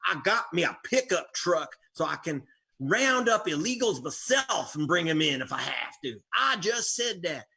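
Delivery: mocking Southern good-ol'-boy voice; mock Southern hayseed accent. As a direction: parody a politician putting on a country accent. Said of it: "I make fun of his accent".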